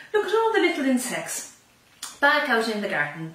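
ordinary delivery

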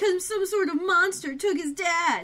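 high, loud mock anguish; melodramatic outburst. Co-host mimicking Eric's outburst about his dad, melodramatic. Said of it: "says it in that tone of voice".

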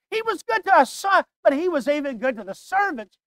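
rising pulpit fervor, pressing each line; preaching at full force. Acted. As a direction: climb to the peak of the sermon. Preaching build.

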